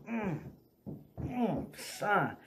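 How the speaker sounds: high, held-in "mm" of pain; imitated pained groan. Re-enacting the hit to the knee, imitated pained groan. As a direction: moan it high through closed lips. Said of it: "and I'm".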